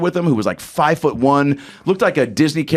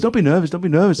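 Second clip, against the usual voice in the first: [name]; 5 semitones higher, faster than usual. Retold or imitated. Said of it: "He goes".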